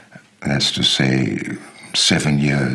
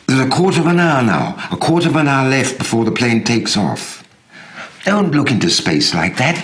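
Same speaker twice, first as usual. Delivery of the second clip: high, louder, pressing voice of the friend; a friend pleading at the airport, higher and louder; urgent friend's voice; 10 semitones higher, 4 dB louder than usual. Retold or imitated. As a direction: urge him on before the plane leaves. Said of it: "I hear you saying that to me".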